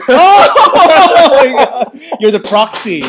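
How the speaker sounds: drawn-out high shriek; laughing. Real, not acted. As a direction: squeal it slowly while laughing.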